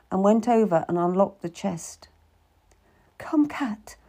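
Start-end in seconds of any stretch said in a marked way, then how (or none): 3.2-4.0 hushed secretive whisper. A narrator's reading. Lady secretly calls Cat to the chest, whispering. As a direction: draw her close in secret.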